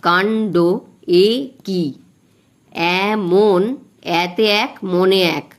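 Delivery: very slow, each syllable of the line drawn out; teacher counting the beats of the verse slowly for students. Shown as a demonstration. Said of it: "এইভাবে আমি মাত্রাগুলো দিয়ে ফেলেছি".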